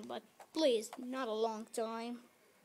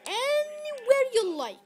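much higher, louder excited call; 13 semitones higher, 9 dB louder than usual. Real, not acted. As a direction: sing it out eagerly. Excited instruction.

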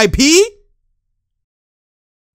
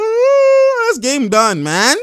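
high, loud mock wail; wailing player; 11 semitones higher, 7 dB louder, much slower than usual. Streamer voices the player giving up, loud high wail. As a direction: open with a high howl, then declare it. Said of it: "He's like".

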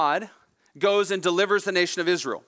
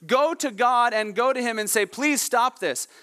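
higher, pleading cry to Moses; desperate cry for help; 7 semitones higher than usual. Acted. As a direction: beg Moses with rising panic. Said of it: "cries out and says".

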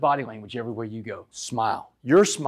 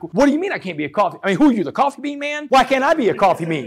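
heated, higher, louder challenge to a cellmate; angry retort; 8 semitones higher, 5 dB louder than usual. Retold or imitated. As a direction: fire back at him in mock outrage. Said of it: "I got mad".

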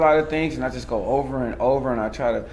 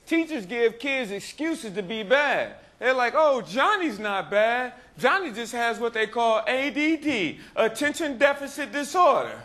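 higher-pitched, projected stage storytelling; stand-up performance; 11 semitones higher than usual. Acted. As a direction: work the crowd with a mock confession.

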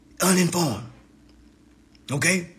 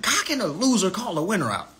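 ordinary talk

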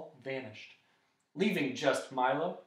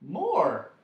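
prompt, louder reply; quick answer; 3 semitones higher, 6 dB louder than usual. A narrator's reading. Milo answering the dodecahedron, quick. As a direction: answer at once. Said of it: "said Milo quickly".

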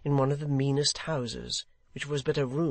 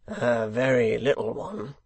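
louder, grudging growl; 5 dB louder than usual. A narrator's reading. Hangman answering the secretary, growling. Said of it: "growled the hangman".

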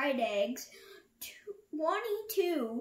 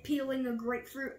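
plain reading voice

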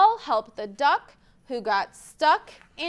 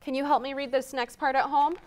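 ordinary talk, nothing marked

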